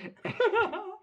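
loud squealing laugh; high shrieking laugh. Real, not acted. Big laugh after the line.

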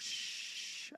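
long soft shush; drawn-out quiet shush; self-soothing shush. Acted. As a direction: hush yourself gently. Quieting her own heart.

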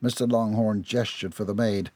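usual speaking voice

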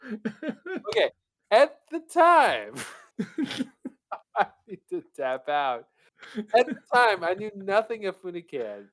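high, loud, struggling through giggles; can barely get the words out; laughing while reading. Real, not acted. Reader still cracking up.

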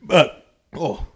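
talking through a burp; burping the words. Real, not acted. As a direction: burp while saying it. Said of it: "sorry, that was like a burp and a talk".

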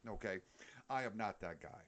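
normal talking voice

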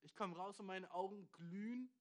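normal talking voice

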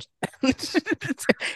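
high burst of laughter. Real, not acted. Laughing about the sister's Iron Man cheer. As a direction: laugh at the memory.

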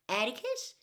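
lightly voiced little girl lost in the dark; a child's call. A narrator's reading. Scout calling for her father in the dark, a small rising call.

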